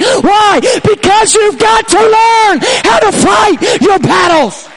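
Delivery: loud, urgent exhortation building to a drawn-out high shout; excited shouted preaching. Real, not acted. Preacher urging young people to stand and fight, excited shouting. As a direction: drive them onto their feet. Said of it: "I get a little bit excited here".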